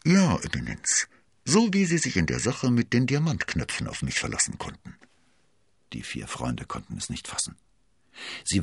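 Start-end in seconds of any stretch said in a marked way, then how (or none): 0.1-5.0 lightly voiced woman, pitch lifted; crisp, clear Milady. A narrator's reading. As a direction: answer the cardinal clearly and without hesitation. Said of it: "kam klipp und klar".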